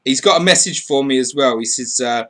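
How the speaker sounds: ordinary talk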